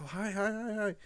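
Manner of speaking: high, eager doggy greeting; high-pitched happy dog voice; excited dog. Pretend. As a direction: voice a thrilled puppy saying hi.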